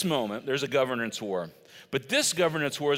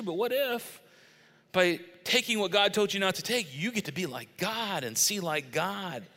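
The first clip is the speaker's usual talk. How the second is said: coaxing tempter, pitched up then easing down; Satan; 5 semitones higher than usual. Retold or imitated. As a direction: dangle the temptation, stretching it out. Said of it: "Satan's crafty".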